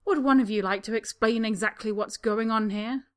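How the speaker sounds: cold demand. A narrator's reading. Spa receptionist coldly questioning Harry and Draco. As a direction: freeze them with the question. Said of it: "stares coldly".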